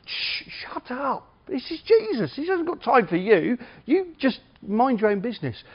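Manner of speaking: high-pitched, hammed-up shushing; scolding crowd. Crowd telling blind Bartimaeus to leave Jesus alone, mock scolding. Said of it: "and the crowd go".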